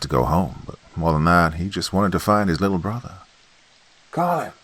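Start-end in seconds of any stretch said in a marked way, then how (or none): 4.1-4.5 high call into the dark; crying out. Acted. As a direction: call his brother's name, high and desperate. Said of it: "he cried".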